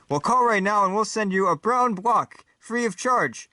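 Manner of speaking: high, slow commercial sales pitch; announcer pitch. Acted. Announcer selling brown blocks to viewers, high commercial voice. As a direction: sell it like a TV announcer, drawn out. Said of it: "Phil the announcer".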